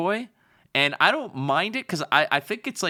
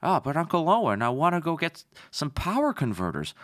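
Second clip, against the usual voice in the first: put-on a light whiny farm boy complaining to his uncle; mild mock whine of Luke Skywalker; 3 semitones higher than usual. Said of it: "Can't you just be like".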